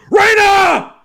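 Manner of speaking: very high, loud cry of her name; anguished scream. A narrator's reading. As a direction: scream as she is dragged away. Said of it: "he screamed".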